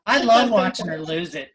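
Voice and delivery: ordinary talk, nothing marked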